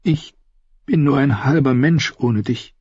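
high-pitched woman's voice, yearning; anguished Cersei. Acted. Sister telling her lost brother how afraid she was, high yearning voice. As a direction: confess your fear in a high, tender voice.

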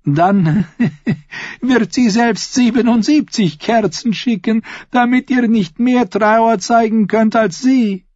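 very high, loud, laughing voice. A narrator's reading. Lady-in-waiting mocks the young queen, laughing. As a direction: burst out laughing, then keep it high. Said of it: "Taena lachte".

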